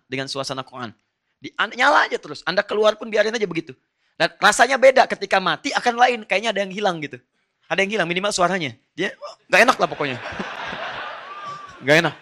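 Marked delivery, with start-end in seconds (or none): 1.4-10.2 rising to an animated, louder pitch of enthusiasm; preacher getting carried away, voice climbing high; excited. Real, not acted. Preacher urging listeners to keep the Quran playing, animated and loud. 10.2-11.8 breathy unvoiced chuckle; a quick soft laugh at himself. Real, not acted. Preacher laughing at his own point to the audience, soft laugh.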